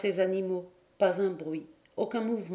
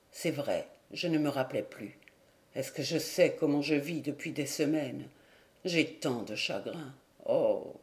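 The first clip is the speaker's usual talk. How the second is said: low, soft, heavy with sorrow; a broken man apologising through grief; grief-stricken; 5 semitones lower, 3 dB quieter than usual. Acted. Grieving husband apologises to his old friend, low and sorrowful.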